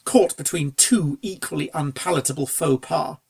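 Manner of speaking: plain reading voice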